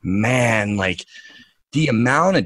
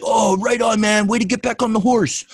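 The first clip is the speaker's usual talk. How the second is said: high-pitched, quick mock encouragement; sarcastic high-voiced cheer; 11 semitones higher than usual. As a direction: parody a gullible fan cheering someone on. Mocking supporters' hand-clapping cheers.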